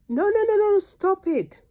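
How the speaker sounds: high, loud no no no; emphatic shout. Real, not acted. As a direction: shout them down to stop. Cuts off own mockery.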